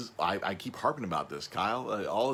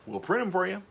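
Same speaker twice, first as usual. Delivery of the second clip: eager salesman; 10 semitones higher, 4 dB louder than usual. Retold or imitated. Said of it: "be like".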